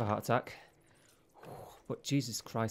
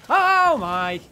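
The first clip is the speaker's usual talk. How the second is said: sudden high yelp of fright; startled shriek; 13 semitones higher, 15 dB louder than usual. Real, not acted. Gamer jolted by a monster, startled high shriek. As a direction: jump out of your skin and shriek high.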